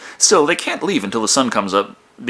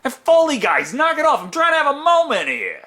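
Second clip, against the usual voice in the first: mock yell. Yells at film's sound crew.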